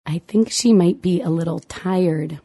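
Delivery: ordinary delivery, nothing marked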